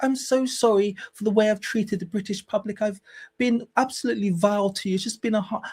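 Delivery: high, mock-contrite American voice; Meghan Markle apologizing. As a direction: imitate a gushing public apology. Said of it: "if she came out and she was like".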